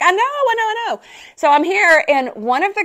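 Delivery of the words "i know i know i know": high, apologetic repeated admission; sheepish reply. Retold or imitated. She answers friends who missed her, high sheepish admission. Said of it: "I'm like".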